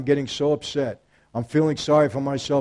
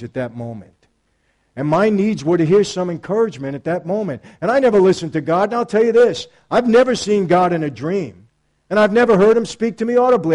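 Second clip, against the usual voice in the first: louder, higher testimony preaching; 5 semitones higher, 7 dB louder than usual. Acted. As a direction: preach it out to the congregation. Preaching to the people.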